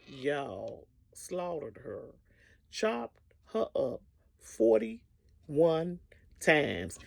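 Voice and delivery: drawn out very slowly and quietly; slow grim emphasis. Real, not acted. Telling the audience the killing, very slow and hushed. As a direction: let each word land slowly and darkly. Grim climax of murder story.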